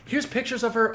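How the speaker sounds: high-pitched, eager nervous voice; nervous retelling. Retold or imitated. Man showing the mom his wife's pictures, nervous. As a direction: imitate a flustered man showing off photos. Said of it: "he's like, really nervous".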